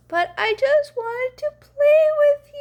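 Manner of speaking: a sad monster yelling out; monster yells. Acted. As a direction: wail it like a hurt big monster. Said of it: "He yells".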